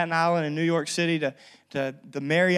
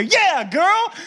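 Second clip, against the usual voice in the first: playful; 11 semitones higher, 6 dB louder, slower than usual. Retold or imitated. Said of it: "pressing for an answer".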